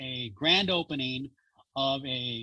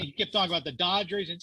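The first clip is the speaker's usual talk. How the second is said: normal talking voice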